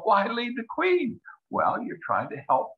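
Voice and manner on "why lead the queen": mock puzzled question. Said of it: "You go".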